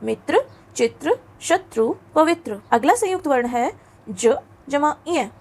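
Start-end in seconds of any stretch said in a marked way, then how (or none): 0.0-2.6 मित्र and पवित्र with a clear त्र; model pronunciation. Shown as a demonstration. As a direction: repeat the त्र words for the class. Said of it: "जिसे हम त्र कहते हैं". (त्र वाले शब्दों का स्पष्ट उच्चारण)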